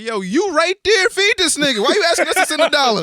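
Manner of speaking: very loud, high-pitched comic shouting; mock outraged yelling. Reacting to a charity ad, hammed-up outraged shout. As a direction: yell at the camera crew, voice shooting up.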